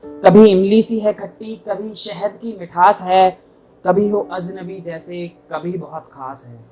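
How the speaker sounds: ringing openings, falling line ends; mushaira-style verse delivery; poetry recitation. Acted. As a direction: recite to an audience, measured and emphatic. Recites own poem to audience.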